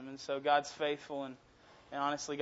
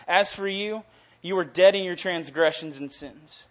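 louder, forceful scripture reading; preaching scripture; 7 dB louder than usual. Acted. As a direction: proclaim the verses.

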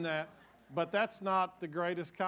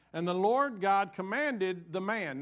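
slow, weighty scripture read; 4 semitones higher, 5 dB louder, slower than usual. Acted. Reads scripture aloud.

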